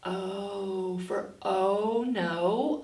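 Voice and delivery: very slow "O for oh no"; slow letter phrase. Shown as a demonstration. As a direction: stretch each word for the class. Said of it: "He could not make the O sound".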